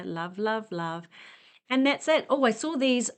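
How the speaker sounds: ordinary talk, nothing marked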